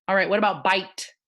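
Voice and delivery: ordinary delivery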